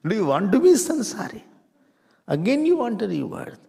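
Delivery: loud, high rhetorical challenge to the room; challenging call-out. Real, not acted. Preacher to the class, a loud challenging question. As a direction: throw the question at them.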